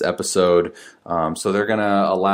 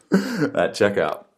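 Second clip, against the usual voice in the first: laughing; slower than usual. Shown as a demonstration. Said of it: "I don't know how many times I can say it".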